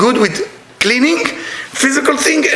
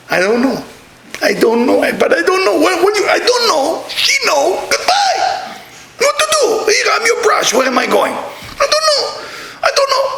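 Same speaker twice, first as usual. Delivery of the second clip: much higher, shouted mock-helpless dithering; helpless husband; 11 semitones higher than usual. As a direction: play a clueless husband who knows nothing.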